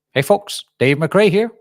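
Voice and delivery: hammed-up presenter hail, rising and loud; Dave McRae. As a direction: launch into a booming, high-pitched intro impression. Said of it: "give us your Dave McRae impression".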